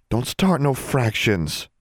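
high, slow plea; pleading. A narrator's reading. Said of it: "Constance pleaded".

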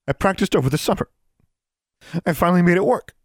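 high, tearful voice choking; choked up. Acted. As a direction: fight back tears as you speak. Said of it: "Her voice choked".

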